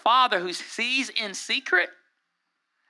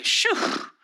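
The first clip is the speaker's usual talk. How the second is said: high airy exhale of admiration; short whistly breath of amazement; impressed "shoo". Retold or imitated. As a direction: imitate a wowed onlooker's "shoo". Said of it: "look at me and say".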